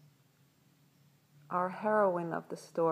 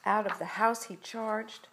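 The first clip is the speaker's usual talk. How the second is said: ordinary delivery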